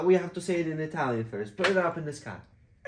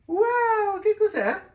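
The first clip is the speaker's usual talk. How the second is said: put-on much higher, louder wow for the kids; mock amazement; 6 dB louder, slower than usual. Father marvelling at what the child shows, high and loud. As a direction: play big amazement at the child's find.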